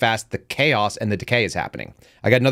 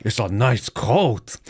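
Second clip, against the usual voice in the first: drawn-out slow impression of a movie villain; movie bit-role villain; much slower than usual. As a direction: stretch it out slowly in a put-on film voice. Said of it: "he's like".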